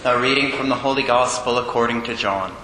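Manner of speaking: low, solemn, measured liturgical proclamation; Gospel reading. Acted. Priest reads the Gospel to the congregation, solemn and low.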